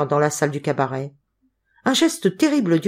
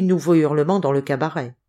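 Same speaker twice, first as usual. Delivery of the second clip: ordinary delivery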